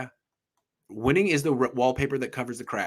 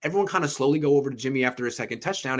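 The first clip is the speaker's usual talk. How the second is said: ordinary talk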